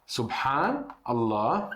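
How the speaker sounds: slow, careful Arabic Subhanallah with a breathy ħ; Arabic pronunciation. Shown as a demonstration. Teacher to Portuguese learners, slow careful Arabic. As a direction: draw out Subhanallah syllable by syllable. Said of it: "eu vou escrever em árabe".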